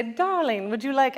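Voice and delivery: put-on half-done British accent, lifted in pitch; mock British accent. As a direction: imitate mom putting on a British accent. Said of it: "She would speak in a British accent".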